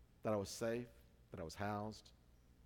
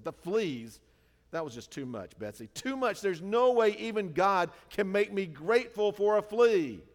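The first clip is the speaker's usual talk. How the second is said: high, loud fed-up protest; exasperated; 7 semitones higher, 10 dB louder than usual. Retold or imitated. Corrie protesting to Betsy, loud exasperation. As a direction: throw up your hands at it.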